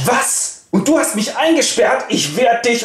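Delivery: high, furious outburst of a hot-headed prince; choleric outburst. Acted. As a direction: blow up in a rage. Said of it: "völlig cholerisch". (cholerischer Wutausbruch, hoch und laut)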